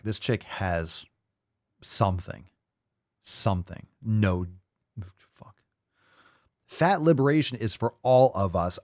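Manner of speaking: ordinary talk, nothing marked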